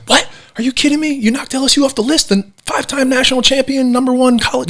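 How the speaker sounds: outraged you'd drop the champs, squeaky high; incredulous coach. Retold or imitated. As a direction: coach hearing he was dropped from the list, imitated shock. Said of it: "And he's like".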